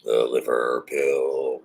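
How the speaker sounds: very low, slow dramatic voice; dramatic announcer. Pretend. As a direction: intone it like a trailer.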